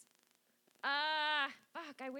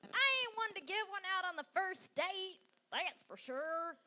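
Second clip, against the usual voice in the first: hammed-up hillbilly twang, pitched up; terrible hillbilly accent; 4 semitones higher than usual. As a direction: put on a bad hillbilly accent. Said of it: "That's a terrible hillbilly accent".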